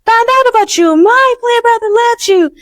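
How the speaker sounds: fangirling at a star, breathless with excitement; gushing fan. Retold or imitated. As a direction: squeal it like a fan meeting their idol. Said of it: "I was like".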